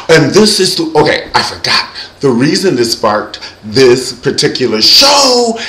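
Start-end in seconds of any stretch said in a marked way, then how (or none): none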